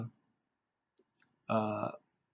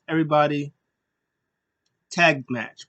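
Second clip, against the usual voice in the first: announcing shout; 3 semitones higher, 6 dB louder than usual. Retold or imitated. Official announcing a tag match.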